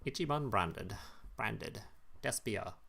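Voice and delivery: understated imitation of a Japanese accent; mock Japanese voice. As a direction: try it quietly in a mock Japanese voice. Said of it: "you know, Japanese voice".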